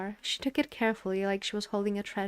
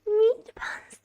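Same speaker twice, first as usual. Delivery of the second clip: excited whisper; 13 semitones higher, 6 dB louder than usual. Acted. Repeated, whispered.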